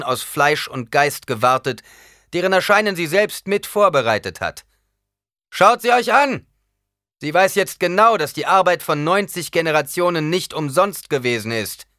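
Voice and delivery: laut und hoch vor der Menge ausgerufen; lauter. A narrator's reading. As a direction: wie eine Ansprache an die Kameraden. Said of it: "Lauter sagte Paul". (Paul ruft laut zu den Kameraden)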